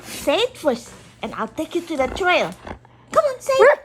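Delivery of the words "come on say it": high loud coaxing. Real, not acted. Coaxing dog to speak.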